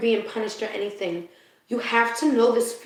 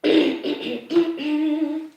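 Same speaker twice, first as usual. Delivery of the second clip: asthma coughing; 5 semitones higher than usual. Real, not acted. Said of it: "I go into asthma attacks".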